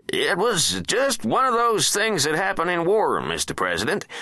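high-pitched, nervous defence secretary; nervous explanation. A narrator's reading. Werner excusing the bombing to the president, nervous and high. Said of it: "nervously cleared his throat".

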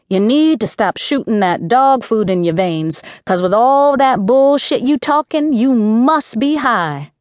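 rising, scornful jab, slowing at the end; mock her for talking nonsense; scornful accusation. A narrator's reading. Mink accuses aunt of being high.

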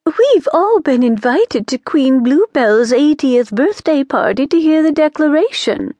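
a smug witch boasting about the party. Acted. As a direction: show off the invitation. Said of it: "Missus Pragg looked smug".

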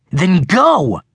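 high, forceful dare; impatient command. A narrator's reading. Boy goading his rival at the cliff edge, impatient. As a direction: push him to act now. Hayden goads Russell before shoving him.

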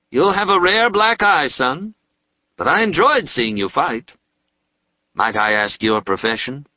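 a fatherly prisoner chatting; portly dentist. Acted. Dentist speaks to Nathaniel.

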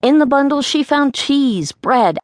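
plain reading voice

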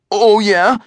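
defiant comeback; shooting back. A narrator's reading. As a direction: throw it back as a challenge. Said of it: "Eugene shot back".

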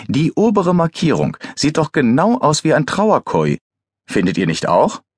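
high-pitched, gleeful proclamation that settles into a question; amused announcement. A narrator's reading. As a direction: announce your discovery with relish. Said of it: "Sichtlich amüsiert verkündete der Pathologe".

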